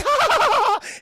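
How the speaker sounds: high, loud staccato fake laugh; mock laugh. Streamer imitates Padme laughing at the teasing, mock laugh.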